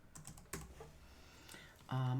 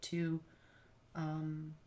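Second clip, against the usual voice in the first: ordinary talk, nothing marked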